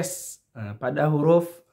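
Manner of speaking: usual speaking voice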